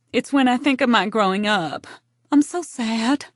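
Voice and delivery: mildly tearful, high and strained; choking back tears. A narrator's reading. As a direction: hold back mock tears on the last words. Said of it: "Evie would choke back her tears".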